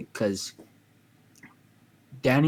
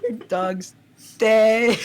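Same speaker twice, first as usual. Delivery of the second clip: high, loud yell landing on dead; super loud scream; 10 semitones higher, 6 dB louder, slower than usual. Retold or imitated. Gamer yelling at Danny in the Discord, high loud scream. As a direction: belt the last word out. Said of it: "Super loud".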